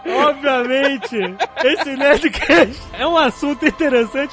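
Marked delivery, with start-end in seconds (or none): 0.1-2.8 choking. Real, not acted. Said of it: "Eu até engasguei".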